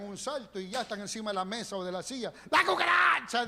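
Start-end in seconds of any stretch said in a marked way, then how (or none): 2.5-3.5 high, loud mock scream of fright; mock scared shriek. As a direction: shriek like you just saw a roach. (chillido burlón de susto)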